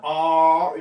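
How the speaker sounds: a long, loud R, tongue tip clear of the palate; tongue-free R. Shown as a demonstration. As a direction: draw out "R" without touching the roof of the mouth. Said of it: "혀 끝이 입천장에 닿으면 안 됩니다".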